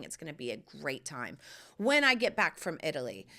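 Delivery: ordinary delivery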